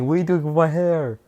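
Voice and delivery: high, loud mock panic; panicked yelp. Monoma being beaten, yelping in mock panic, high and loud. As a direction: yelp it as the fire starts. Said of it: "he's like".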